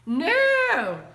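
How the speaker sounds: loud, high, stretched "No"; firm refusal. A narrator's reading. Mother answers the little sister, emphatic refusal. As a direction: refuse sharply, drawing the word out.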